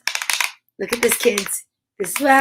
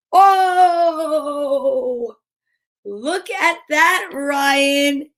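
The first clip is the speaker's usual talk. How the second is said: high, slow, drawn-out delight; pitched-up, lingering admiration; delighted wonder; 5 semitones higher, much slower than usual. Real, not acted. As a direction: savor the reveal, stretching the words. Admires the tea reveal.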